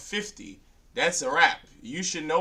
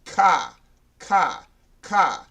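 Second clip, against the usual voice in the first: loud, higher Boston "cah", repeated; Boston-style Ka; 4 semitones higher, 7 dB louder, much slower than usual. Shown as a demonstration. Teacher drilling the helpful way to remember, loud Boston Ka. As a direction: drill Ka like a Bostonian saying car. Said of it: "pronounce it like you from Boston".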